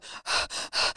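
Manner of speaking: quick, voiceless panicky breaths; panicked breathing. Acted. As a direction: mime an anxiety attack in breaths. Acts out panic Xanax was for.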